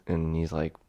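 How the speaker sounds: ordinary talk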